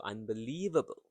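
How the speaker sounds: "unbelievable" with "lie" stressed and lengthened; stress on third syllable. Shown as a demonstration. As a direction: hit and hold "lie" in "unbelievable". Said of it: "stressed and prolonged".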